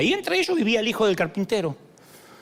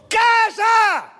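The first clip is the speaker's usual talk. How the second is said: sharp, much higher, loud command; shout; 6 dB louder than usual. Acted. Jesus rebukes the wind, shouting. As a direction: bark it at the storm. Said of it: "le gritó".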